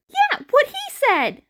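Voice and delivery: high, loud eager backup voice; chiming-in bystander. Acted. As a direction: pipe up in agreement. Another voice chiming in.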